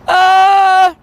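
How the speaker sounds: high sustained "ah" yell; imitation of a first-try yell; student's held-back yell. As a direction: yell loudly but hold something back. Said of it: "Yell as loud as you can".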